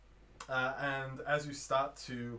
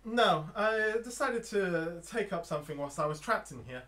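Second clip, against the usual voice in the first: higher-pitched trapped woman; female voice; 4 semitones higher, 4 dB louder than usual. Acted. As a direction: speak up as a woman stuck inside. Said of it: "It's a female voice".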